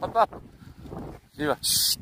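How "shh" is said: ordinary talk, nothing marked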